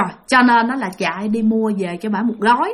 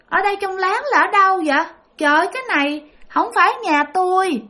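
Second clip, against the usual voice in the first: put-on higher, confused little-child voice; child's voice; 7 semitones higher than usual. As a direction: imitate a lost kid, higher. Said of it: "cái giọng của một đứa con nít". (giọng trẻ con cao, ngơ ngác)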